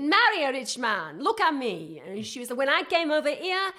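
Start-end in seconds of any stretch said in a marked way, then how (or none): 0.0-2.0 imitated Venezuelan accent, higher and louder; Patricia's Venezuelan accent. Quoting Patricia urging her to marry rich, Venezuelan accent. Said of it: "That's how she used to speak". 2.6-3.7 much higher and louder Venezuelan accent impression; Patricia's Venezuelan accent. Patricia bragging about her surgery, imitated Venezuelan accent. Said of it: "That's how she used to speak".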